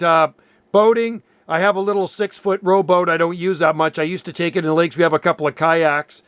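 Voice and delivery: ordinary talk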